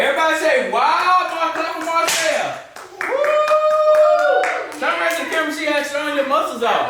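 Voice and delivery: excited hype. Real, not acted. Hyping son's strength.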